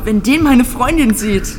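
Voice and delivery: ordinary delivery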